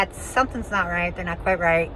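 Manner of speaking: normal talking voice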